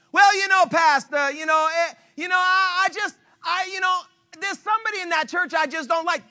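high, hesitant stammering excuse. Retold or imitated. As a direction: fumble for a weak excuse. Churchgoer stammers excuses to pastor.

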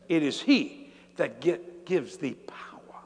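drawn-out verse sinking into a whisper; slow scripture, fading at the end; slow, weighty recitation. Real, not acted. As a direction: let each word land, then hush. Slow hushed repeat of the verse.

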